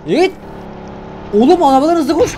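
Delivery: high loud frantic yells; real terrified screaming; panicked screaming. Real, not acted. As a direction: shriek as it chases you down. Being chased, screaming in panic.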